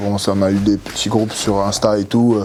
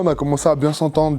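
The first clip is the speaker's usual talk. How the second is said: ordinary talk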